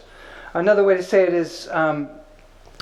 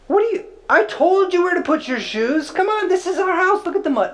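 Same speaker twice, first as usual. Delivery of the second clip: much higher, nagging voice over the muddy shoes; scolding spouse; 13 semitones higher than usual. Acted. Resentful spouse scolds partner about muddy shoes, high nagging voice. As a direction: scold like a fed-up partner pointing at the mud.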